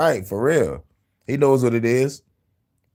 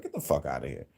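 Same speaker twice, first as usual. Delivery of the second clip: muttered dismissal; 10 semitones lower, 12 dB quieter than usual. Real, not acted.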